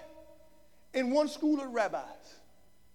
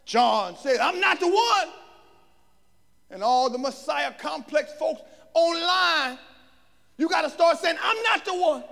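high, loud exhorting preacher; full-force preaching; 6 semitones higher, 8 dB louder than usual. Real, not acted. Preacher confronting those with a Messiah complex, full-force preaching. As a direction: press each point on the congregation.

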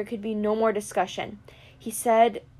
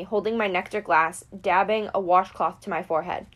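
ordinary delivery, nothing marked

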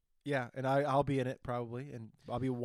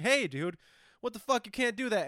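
outraged; 8 semitones higher, 3 dB louder than usual. Retold or imitated. Outraged customer, retold.

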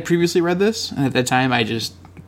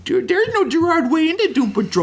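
much higher, whiny mock voice of a pedantic fan; mocking internet commenter; 11 semitones higher than usual. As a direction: parody a know-it-all tweeter, pitched way up. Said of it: "tweeting like".

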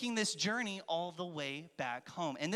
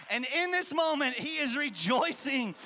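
higher and louder, building celebration; excited rejoicing; 6 semitones higher, 5 dB louder than usual. Real, not acted. Pastor hyping the church about the father's party, jubilant. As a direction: whip up the crowd's joy.

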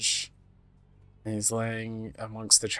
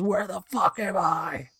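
mild mock yell; 8 semitones higher, slower than usual. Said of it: "he yells and says".

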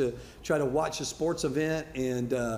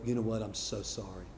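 soft, lower, gentle sorry; sincere apology; 5 semitones lower, 6 dB quieter than usual. Shown as a demonstration. Reasonable person apologizes gently to the grieving father. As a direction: say it softly and humbly. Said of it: "you'd go".

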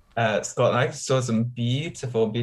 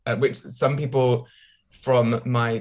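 ordinary talk, nothing marked

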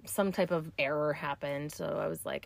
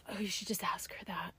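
soft unvoiced whisper; whispered inner voice; 12 dB quieter than usual. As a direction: whisper it as a voice in her head. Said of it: "in my head, I was like".

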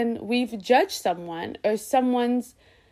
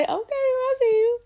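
much higher, bright approving line; what a supportive friend should say, much higher; mock cheerful support; 14 semitones higher than usual. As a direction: sing out a friendly okay. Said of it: "just say".

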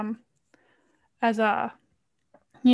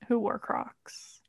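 deadpan whisper; 10 dB quieter, much slower than usual. Real, not acted. Deadpan joke about Crocs.

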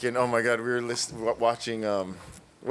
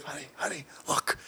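hushed, breathy aside to his wife; whisper; 3 semitones higher, 8 dB quieter than usual. Retold or imitated. Nudging his wife to watch the tourists, whispered. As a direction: whisper it so the guys can't hear.

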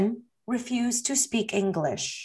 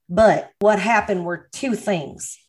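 ordinary talk, nothing marked

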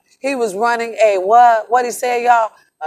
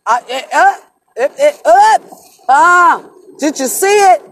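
louder and higher, stammering with emotion; choked up, can't get the words out; emotional outburst; 4 semitones higher, 4 dB louder than usual. Real, not acted. Overcome recalling the salute.

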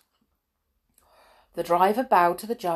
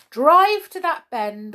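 loud, slow, raised command; ordering; 8 semitones higher, 8 dB louder than usual. A narrator's reading. Judge ordering the brownies, loud command. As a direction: give a firm order. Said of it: "ordered the judge".

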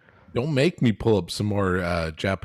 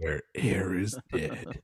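booming movie trailer narrator; 7 dB quieter, slower than usual. Pretend. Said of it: "do you like movie theater trailer guy?".

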